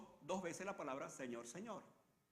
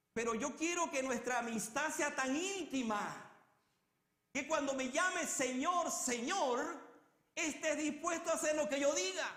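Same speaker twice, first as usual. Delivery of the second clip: impassioned plea; 7 semitones higher, 8 dB louder than usual. Acted. Preacher voicing Jesus' plea.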